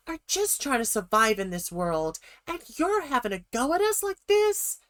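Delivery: hammed-up Jill defending herself and her little Kendall; playful impression of Jill talking back to Kathy. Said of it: "I'm the best Jill impressionist in the world".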